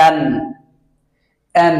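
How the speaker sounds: "an" with the final n pressed and held. Shown as a demonstration. Teacher showing learners the n in an, pressed N. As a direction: lean on the n at the end of "an". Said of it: "চাপ দিয়ে বলতে হবে".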